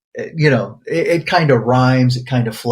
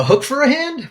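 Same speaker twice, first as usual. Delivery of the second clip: excited; 12 semitones higher than usual. Real, not acted. Excited guess after trying.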